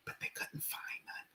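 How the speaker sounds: hushed whisper, breathy and fast. Real, not acted. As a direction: whisper it under your breath. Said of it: "And I have to whisper".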